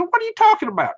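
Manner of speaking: very high, loud disbelieving question; retold incredulity. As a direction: demand an explanation, utterly baffled. Retells reacting to a shocking remark.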